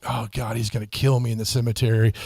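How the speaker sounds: half-joking panic under the breath; a hint of comic dread; mock-scared aside. Scared thought as giant approaches.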